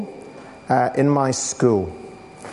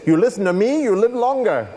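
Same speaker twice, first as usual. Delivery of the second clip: a hint of a Short Round accent, raised; lightly done Short Round voice, pitched up; 6 semitones higher than usual. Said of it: "I'm not very good at the accent".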